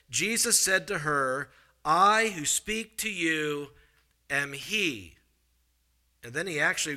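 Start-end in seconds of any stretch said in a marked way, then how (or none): 1.9-5.1 very slow, stretched proclamation; dramatic, weighty reading. Acted. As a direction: draw each phrase out, then pause before the last words. Dramatic reading of Jesus' revelation.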